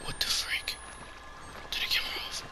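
hushed frightened whisper. Real, not acted. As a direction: whisper so it can't hear. Hiding from a monster.

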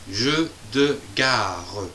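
jeu de gare stretched out slowly; drawn-out teaching read; slow syllable reading. Shown as a demonstration. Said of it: "Deux syllabes".

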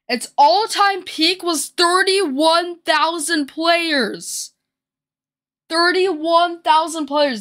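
amazed. Real, not acted. Amazed at player count.

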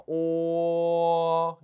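long level 오 with a slight 어 at the end; 오 gliding to 어. Shown as a demonstration. Teacher showing the vowel, 오 held and opening into 어. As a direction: start on 오, let it open toward 어. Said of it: "약간 어 발음이 나야 됩니다".

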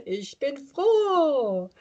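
joyful cheer. Shown as a demonstration. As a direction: stretch froh out happily.

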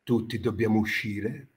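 solemn, soft pompous poet; pompous poet introduction. Acted. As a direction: announce your poems with mock gravity. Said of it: "entrato nel personaggio".

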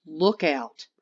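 ordinary delivery, nothing marked

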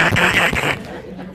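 rapid-fire mouth-made flash noise; imitated flash bursts. Shown as a demonstration. Imitates his flash firing at bird.

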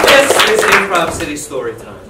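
loud show announcement; announcer. Acted. Host opening the improv show, loud announcer voice.

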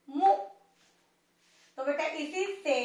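usual speaking voice

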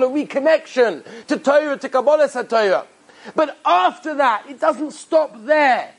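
high, fervent sermon voice; high-pitched, emphatic exhortation; impassioned preaching. Acted. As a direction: drive the moral home with fervor. Preaches the moral at full force.

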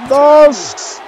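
high, loud pin count and win; victory shout. Acted. (grito de victoria)

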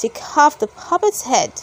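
ordinary delivery

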